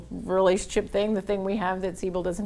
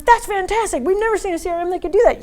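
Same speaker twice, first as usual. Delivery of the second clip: very high, fast and louder; amazed praise; 11 semitones higher, 6 dB louder, faster than usual. Retold or imitated. Bankers praising the demo, very high, fast and amazed. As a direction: rave like you've seen a miracle.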